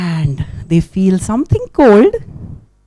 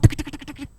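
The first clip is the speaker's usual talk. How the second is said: soft ticking steps; 3 semitones higher, 10 dB quieter, much faster than usual. Acted. Tiny feet walking.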